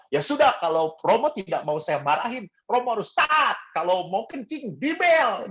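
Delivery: mock scolding that climbs high; imitating a scolding doctor. Doctor telling the priest to ring the bell, scolding. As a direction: lay down the rule, pitch jumping up on the orders. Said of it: "dokternya tuh marah-marah terus".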